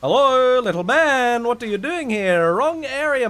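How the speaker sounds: high, loud teasing voice to a little man; sneering sing-song taunt; mock greeting. Streamer taunts a small game character, high and loud.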